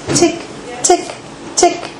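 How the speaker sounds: high, spaced-out tick noises; crisp little high ticks with gaps between; hammer ticking. A narrator's reading. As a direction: tick like a tiny hammer on leather. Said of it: "went the leprechaun's hammer".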